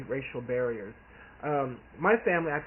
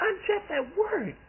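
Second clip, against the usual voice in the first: mock protest. Protesting to aunt in retold talk.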